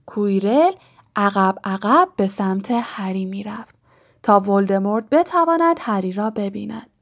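usual speaking voice

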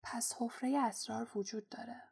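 murmuring. A narrator's reading. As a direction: murmur it in dawning realisation. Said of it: "هری زمزمه کرد".

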